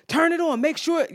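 high, insistent mimicked orders; lecturing. Stranger ordering her to check the car, lecturing. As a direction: lecture her as the pushy man. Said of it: "he's, like, lecturing me".

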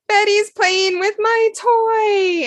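put-on a small girl telling on her sister; child's complaint. Jen voiced as small child.

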